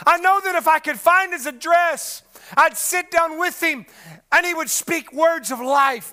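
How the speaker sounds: loud, high impassioned preaching cry; preaching at full force. Acted. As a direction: shout Job's questions to the rafters, pleading.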